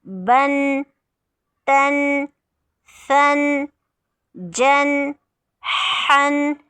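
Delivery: बन तन सन each a separate, lifted, careful syllable; drill recitation. Shown as a demonstration. Teacher reciting tanween syllables for students, careful drill. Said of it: "अदायगी ठीक करनी है".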